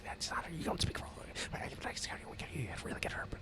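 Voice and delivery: hushed, hurried argument in whispers; whispering. Acted. Cultists argue among themselves down the hole, whispering. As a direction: whisper frantically, far below speaking level. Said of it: "It's like whisper, like".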